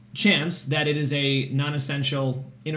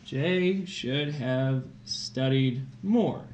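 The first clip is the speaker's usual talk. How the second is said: very slow, word by word as she writes; slow dictation; 2 semitones lower, much slower than usual. Shown as a demonstration. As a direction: dictate Jay should have studied more slowly. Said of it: "Here we go".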